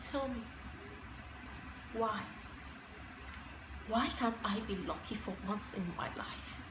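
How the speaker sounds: very soft, halting lament; forlorn. Acted. Asking why luck never comes, forlorn. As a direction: plead quietly, with long pauses between questions. Laments the failed date, after tears.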